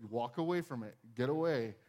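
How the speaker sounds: ordinary talk, nothing marked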